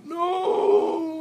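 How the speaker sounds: very high crying shout; hysterical scream. A narrator's reading. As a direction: shriek in hysterical panic. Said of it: "Frank hysterically".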